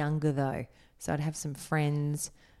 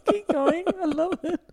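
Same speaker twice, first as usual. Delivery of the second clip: much higher, laughing encouragement; laughing cheers for the friend song; delighted laughing; 13 semitones higher, 5 dB louder than usual. Real, not acted. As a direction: cheer him on through laughter.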